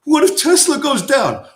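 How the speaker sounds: high, mock-worried whine; mock concerned banker. Mocking Wall Street's worry about Tesla. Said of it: "tell you that they're concerned".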